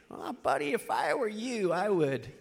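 very soft, mock boozy counsel; hushed impression of a drinking buddy; tipsy bar buddy. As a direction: imitate a juiced-up pal giving advice under his breath. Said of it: "other guys that are at the local bar, a little juiced up".

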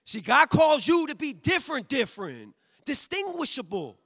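loud, high, climbing pulpit call; full-force preaching. Acted. Preacher rousing the church about being different, full-force preaching. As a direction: drive it home to the congregation.

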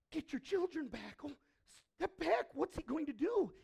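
high, loud panicked cry; panicked crowd. Retold or imitated. Frightened crowd seeing a leper, alarmed and loud. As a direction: shoo the kids back in alarm.

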